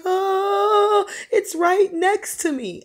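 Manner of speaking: high, drawn-out terrified wail; very high, stretched-out cry of dread; screaming in terror. Retold or imitated. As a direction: imitate a man screaming in fear. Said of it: "All I know is he's screaming".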